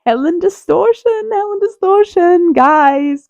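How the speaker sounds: giddy fan calling her favourite; excited announcing. Real, not acted. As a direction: gush the name twice. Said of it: "excited announcing".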